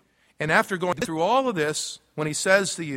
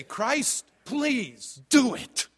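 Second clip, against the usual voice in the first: slow, higher pleading; a humble prayed plea; pleading prayer; 6 semitones higher, much slower than usual. Pretend. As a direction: ask Christ earnestly, drawing it out. Voicing a prayer to Christ.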